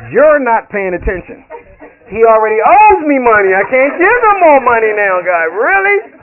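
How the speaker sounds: loud exasperated protest, rising to a high "Really?"; mock protest to God. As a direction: push back at God, loud and incredulous. Arguing back at God.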